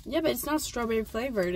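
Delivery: chewing while talking; talking through a bite of candy; mouth full. Real, not acted. Seen 0: Both just bit candy; mouths still working while speaking, speaker unclear.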